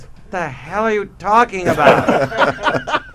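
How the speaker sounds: a baffled car boss losing patience; Lee Iacocca. Retold or imitated. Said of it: "And he's Lee Iacocca".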